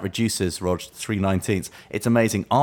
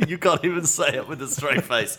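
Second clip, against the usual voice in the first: amused, laughing disbelief; 5 semitones higher than usual. Real, not acted. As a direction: tease through laughter, higher pitched. Cracking up at co-host's claim.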